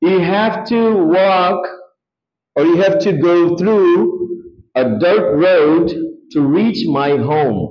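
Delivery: very slow, word by word English; slow model sentence. Shown as a demonstration. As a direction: spell it out slowly for learners. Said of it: "teacher models slow English sentence".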